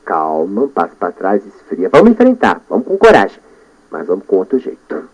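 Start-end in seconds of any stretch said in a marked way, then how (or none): 0.1-1.9 soft, low brake on himself; cool yourself down in a hush; quiet self-calming. Shown as a demonstration. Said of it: "eu breca". (acalmando-se baixinho, bem suave)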